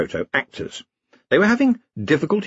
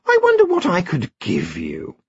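seductive queen; 8 semitones higher than usual. A narrator's reading. Queen tempting.

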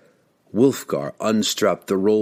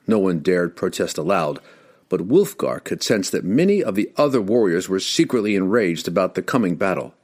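plain reading voice

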